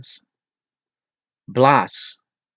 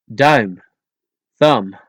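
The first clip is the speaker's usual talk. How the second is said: normal talking voice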